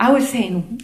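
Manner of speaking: ordinary talk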